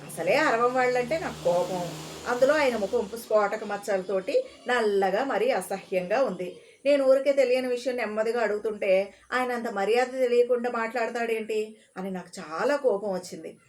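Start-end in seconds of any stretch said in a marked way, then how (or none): none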